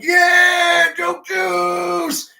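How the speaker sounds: loud, high sing-song yell; drunken yell. Retold or imitated. Drunk fan yelling back at the comedian, sing-song shout. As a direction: belt it back at the stage.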